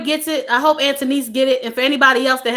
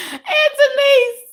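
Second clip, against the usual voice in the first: high, drawn-out name through laughter; helpless laughing cry; 12 semitones higher, much slower than usual. Real, not acted. Host calls out viewer, helplessly amused. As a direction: wail the name while cracking up.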